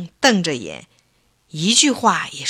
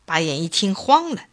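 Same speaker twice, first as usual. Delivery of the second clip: ordinary delivery, nothing marked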